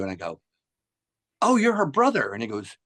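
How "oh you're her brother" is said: high-pitched acted surprise; surprised realisation. Singer realises who the guest is, surprised. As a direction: replay the moment it dawns on you. Retold sudden realisation about the brother.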